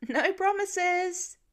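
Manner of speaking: slow, stretched teasing call; cheeky yell back. A narrator's reading. Daughter yelling back to her dad, teasing. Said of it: "to yell back".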